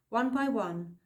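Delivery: ordinary delivery, nothing marked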